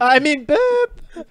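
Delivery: high, slow sassy boop; sassy intercom boop. Pretend. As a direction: drawl the boop with attitude.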